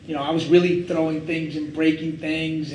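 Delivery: normal talking voice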